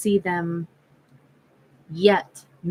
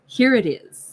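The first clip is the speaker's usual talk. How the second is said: put-on higher, punchy New York accent; 4 semitones higher, 4 dB louder than usual. Recalling a straight-talking friend, imitated New York accent. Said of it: "a friend who had a New York accent".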